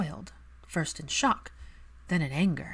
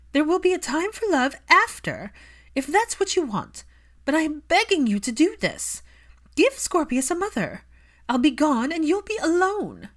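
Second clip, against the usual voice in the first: higher, louder pleading mother; mother begging her son to marry, higher and louder; Narcissa begging; 11 semitones higher, 7 dB louder than usual. A narrator's reading. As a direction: beg your son as a desperate mother. Narcissa voiced by reader.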